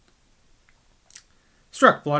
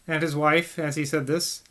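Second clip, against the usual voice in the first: ordinary delivery, nothing marked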